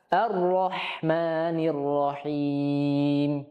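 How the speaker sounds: very slow chanted verse; Quran recitation. Shown as a demonstration. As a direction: intone the whole verse slowly.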